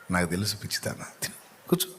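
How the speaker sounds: soft, hushed and affectionate; tender husband. Acted. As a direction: comfort her gently, almost breathing it. Husband comforts his weeping wife. (లాలనగా, మెల్లని గుసగుస)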